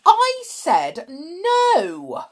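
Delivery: high, loud, drawn-out no; snapped refusal. A narrator's reading. Matron refuses Tom's plea, snapping. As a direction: shut him down angrily. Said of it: "snapped Matron".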